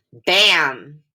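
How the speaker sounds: "bam" with the a changed by the m; glued am sound. Shown as a demonstration. Teacher models the word for the class. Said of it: "When we say the word".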